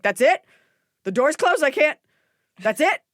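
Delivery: mock the husband's disbelief at a shut door; incredulous husband. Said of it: "He was like".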